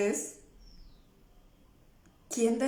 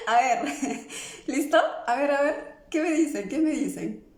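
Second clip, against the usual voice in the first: louder and higher, through laughter; laughing; 5 semitones higher, 4 dB louder than usual. Real, not acted. Asking viewers what they think, loud and laughing. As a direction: ask the viewers while still laughing.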